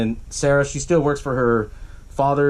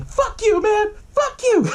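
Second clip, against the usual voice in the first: very high, whiny mock teen voice; teenager; slower than usual. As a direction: imitate a defiant teen, voice cracking high. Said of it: "do that teenager thing".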